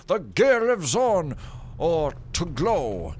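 loud, high preachy proclamation; grand church proclamation. Pretend. Gamer reading the church notice, grand preachy voice.

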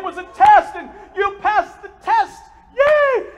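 loud high cheer, yay at the top; shouted family cheer. Retold or imitated. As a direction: celebrate like a surprise party. Family cheering groom passed test.

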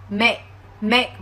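"mẹ" said like "make" with the K held and never released; "mẹ" as an English "make" with a silent, unreleased K stop. Shown as a demonstration. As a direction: stop the final K without any puff of air, "mẹ". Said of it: "chúng ta phải đọc là mẹ".

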